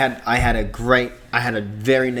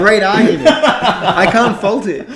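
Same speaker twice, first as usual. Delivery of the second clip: high, loud, slow laughing mock praise; laughing sarcasm; 10 semitones higher, 11 dB louder, slower than usual. Real, not acted. Podcaster mocking the flag argument, laughing sarcasm.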